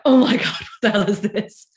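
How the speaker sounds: high, loud, laughing "what the hell"; laughing disbelief. Retold or imitated. Guest retelling her office job to the host, laughing disbelief. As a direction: burst out in amused horror. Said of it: "It was like".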